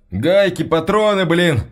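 exasperated echo. A narrator's reading. Soldier scolds boy's excuse.

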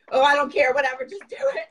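loud, high, shrugging it off; defiant self-talk. Retold or imitated. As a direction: push past the doubt out loud. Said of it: "I was like".

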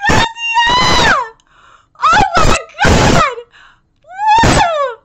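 high, screamed fan cheer; wild cheering. Real, not acted. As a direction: whoop and holler for your hero. Cheering the hero's move.

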